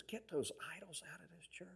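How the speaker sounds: hushed imitation of God speaking; quiet divine command. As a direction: give the order softly. Acts God's quiet instruction.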